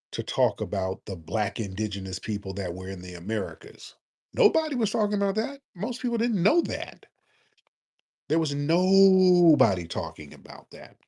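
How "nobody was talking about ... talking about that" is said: emphatic insistence. Real, not acted. Insisting nobody covered this topic.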